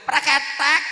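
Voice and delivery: very high loud praketek; wife brushing off the Quran verse with a shrill praketek; mock dismissive noise. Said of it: "Fassolihatu qanitatun hafidhatun".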